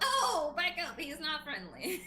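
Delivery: high, loud shooing warning with a laugh; mock warning. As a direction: shoo them back, laughing. Warning people off her rabbit.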